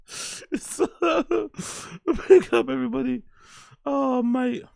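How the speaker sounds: very high, squeezed by laughter; helpless real laughter; laughing. Real, not acted. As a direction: talk through a giggling fit. Recovering from laughing fit.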